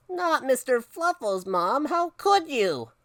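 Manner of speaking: high, drawn-out wounded protest; horrified child. Acted. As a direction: plead like a betrayed little boy. Boy horrified for his bunny.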